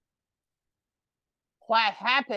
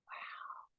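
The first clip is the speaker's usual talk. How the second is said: whispered wow; 24 dB quieter than usual. Real, not acted. Breathless wow.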